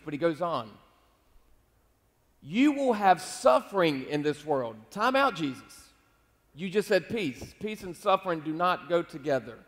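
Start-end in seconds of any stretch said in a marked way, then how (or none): 2.4-4.8 loud, slow scripture declaration; emphatic proclamation. Retold or imitated. Jesus warning his disciples of suffering, loud and drawn out. 4.9-9.6 mock protest. Baffled listener objecting to Jesus about peace and suffering, mock protest.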